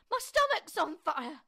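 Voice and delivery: high, loud cry of alarm; shouted. A narrator's reading. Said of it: "she shouted suddenly".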